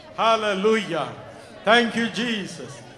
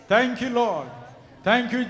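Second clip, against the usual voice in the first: normal talking voice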